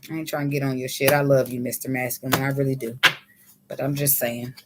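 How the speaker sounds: low, soft, tender aside; sincere reassurance. Real, not acted. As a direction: drop low and soft to take the sting out. Softens scolding, tells him her love.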